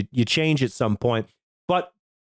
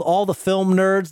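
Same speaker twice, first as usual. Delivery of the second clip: ordinary delivery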